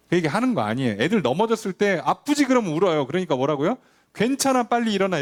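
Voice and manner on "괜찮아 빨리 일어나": high, bright push to get up; jolly the fallen kid back onto his feet; brisk cheer-up. Retold or imitated. Parent telling a fallen child to get up, brisk and cheerful. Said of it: "이렇게 하는 거예요".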